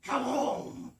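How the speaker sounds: loud booming call; loud monster shout. Acted. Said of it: "suddenly I hear".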